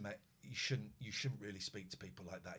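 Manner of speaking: quiet calm repeat. Retold or imitated. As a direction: stay level so he calms down. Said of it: "I kept very calm".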